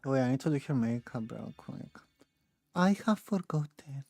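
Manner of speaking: usual speaking voice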